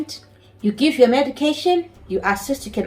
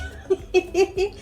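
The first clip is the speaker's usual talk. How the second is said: rueful laugh; 9 semitones higher than usual. Real, not acted. Laugh after "I was pained.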